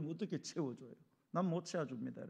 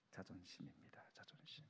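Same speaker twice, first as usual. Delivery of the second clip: hushed reveal; 11 semitones lower, 24 dB quieter than usual. Acted. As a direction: drop to a near whisper, much softer, on 자존심. Key word dropped to whisper.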